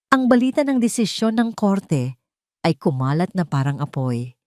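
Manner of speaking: usual speaking voice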